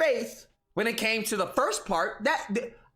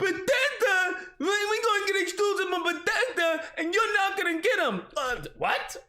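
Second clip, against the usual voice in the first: higher, dopey Xbox fanboy; dim fanboy voice; 8 semitones higher than usual. Acted. Xbox fanboy bragging about Bethesda in a dopey voice. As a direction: play the dopey console warrior. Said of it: "this whole Tard voice thing he's doing".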